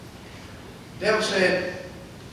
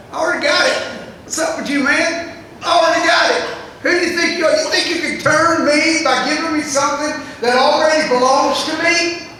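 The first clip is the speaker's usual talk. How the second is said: defiant taunt; 9 semitones higher, 9 dB louder than usual. Pretend. Mocking the devil in Jesus' voice.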